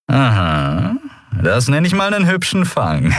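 high, swooping cocky pirate voice; gloating pirate. A narrator's reading. Pirate looking over two captured women, gloating. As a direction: relish the catch.

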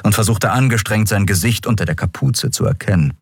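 ordinary delivery

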